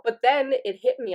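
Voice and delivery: normal talking voice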